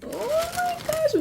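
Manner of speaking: a high delighted squeal at first sight; excited squeal. Real, not acted. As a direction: gush over the shoes in a high squeal. First sight of the glitter shoes.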